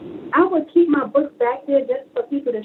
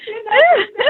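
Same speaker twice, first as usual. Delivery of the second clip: laughing while talking; 10 semitones higher, 5 dB louder, faster than usual. Real, not acted. Laughing through the retelling.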